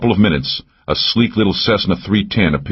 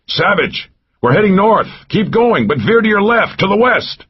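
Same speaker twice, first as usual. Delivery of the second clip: shout. A narrator's reading. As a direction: yell across the forest to him. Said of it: "turned away from him and shouted".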